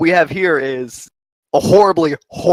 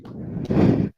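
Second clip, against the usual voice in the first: menacing taunt; much slower than usual. Acted. GM taunts a downed player.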